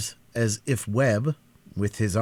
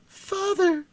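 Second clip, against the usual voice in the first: even higher, desperate repeated call; piercing cry. A narrator's reading. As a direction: call again, more desperate. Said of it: "exclaimed a piercing cry".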